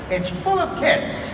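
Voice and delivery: normal talking voice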